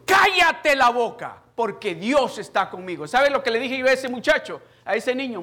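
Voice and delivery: loud, high defiant sermon; full-force preaching. Acted. Preacher telling the devil to shut up, full-force preaching.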